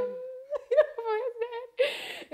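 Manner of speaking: very high, long-drawn fake sob; hammed-up crying, half laughing; mock wailing. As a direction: whine it out in comic despair. Acting out own reaction to father.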